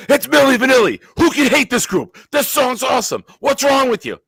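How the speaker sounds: high, loud excited screaming; retold screaming. Host re-enacting screaming at Bobby over the radio, high-pitched yell. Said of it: "And I start screaming out".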